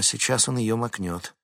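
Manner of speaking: ordinary delivery, nothing marked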